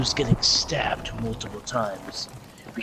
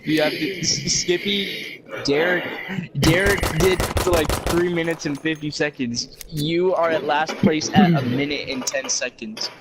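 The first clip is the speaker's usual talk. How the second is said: higher and louder, hyped up; excited announcer; 4 semitones higher, 4 dB louder than usual. Real, not acted. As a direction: call it like a race host. Announcing the race times.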